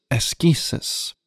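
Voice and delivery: "askesis" slowly, with a hard k; slow Greek-style ah-SKEE-sis; Greek pronunciation. Shown as a demonstration. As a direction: repeat "askesis" the Greek way, drawn out for learners. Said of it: "more of a Greek pronunciation".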